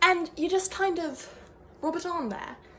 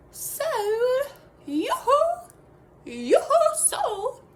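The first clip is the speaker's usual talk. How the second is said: high, louder yoo-hoo call into the distance; sing-song calling; 10 semitones higher, 6 dB louder, much slower than usual. Pretend. Wellness guru calls out high for her lost soul. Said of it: "I would just really be like".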